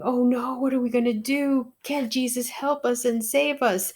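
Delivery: a touch of frightened pleading; panicked disciples. Retold or imitated. Disciples panicking in the storm, mock frightened cry. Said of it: "the disciples are in a panic".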